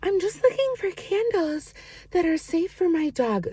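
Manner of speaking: lightly hammed-up customer quote; quoting the candle buyer, high and sweet; imitating the woman. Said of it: "she's like".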